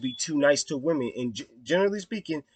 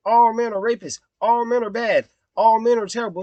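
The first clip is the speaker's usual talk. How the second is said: higher, louder imitated sweeping claim; mock accusation; 7 semitones higher, 4 dB louder than usual. Man quoting what feminists supposedly say, mock accusation. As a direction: mimic accusers, pitched up on each repeat.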